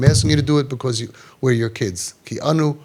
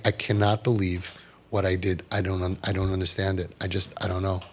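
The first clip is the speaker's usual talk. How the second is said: softer, lower, lost and stunned; softer, lower dazed admission; bewildered son; 5 semitones lower, 8 dB quieter than usual. Retold or imitated. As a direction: mumble it in confused regret. Shocked son voiced later.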